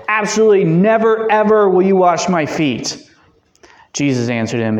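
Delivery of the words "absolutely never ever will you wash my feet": much higher and louder, "Absolutely never, ever" driven home; emphatic refusal. Acted. Peter refusing Jesus outright: high, loud protest. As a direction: protest with full force. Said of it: "It's the strongest negative in the Greek possible".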